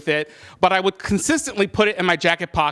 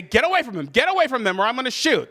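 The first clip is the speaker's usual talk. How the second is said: higher, urgent retold threat; desperate warning; 7 semitones higher than usual. Bluffing with a blank gun at a mugger, retold desperate warning. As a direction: re-enact a panicky warning, pitched up.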